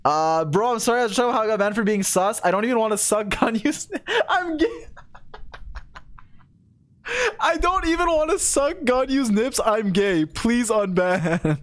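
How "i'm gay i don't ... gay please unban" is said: high wailing start, sinking into laughter; mock tearful plea. Streamer re-reading a banned viewer's appeal, mock tearful pleading. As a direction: beg in a hammed-up sob, dragging the last words.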